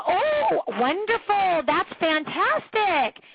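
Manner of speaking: "Oh" jumping very high, slow sappy "fantastic"; sappy, sweet fake praise; over-the-top gushing. Coach imitating over-the-top praise for a stay-at-home mom. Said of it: "this over-the-top response".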